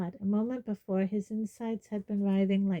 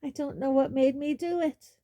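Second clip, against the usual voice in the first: wild panic; 6 semitones higher, 3 dB louder than usual. Acted. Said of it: "he said wildly".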